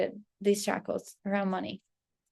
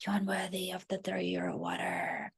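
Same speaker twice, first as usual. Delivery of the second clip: barely voiced put-down from inside; cruel whisper; 2 semitones lower, 8 dB quieter than usual. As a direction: whisper it like a mean secret. Cruel inner voice again.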